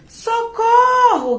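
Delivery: high, loud, drawn-out scream for help; cry for help. A narrator's reading. Little mouse stuck in a tree calls for help, loud. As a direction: yell it out long from the tree. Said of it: "gritou o ratinho".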